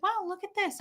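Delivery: high, soft mock-sweet mom; nice mom voice. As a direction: imitate mom being extra nice. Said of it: "my mom was all nice to me".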